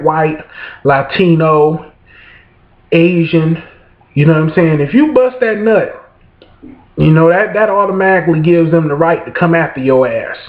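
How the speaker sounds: ordinary talk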